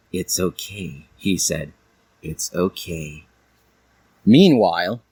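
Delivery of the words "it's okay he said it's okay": low soft comforting voice; soothing. A narrator's reading. Shadow soothes crying Dali, low and soft. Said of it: "massaged her, caressing".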